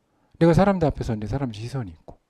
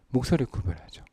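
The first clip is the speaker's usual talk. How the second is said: ordinary talk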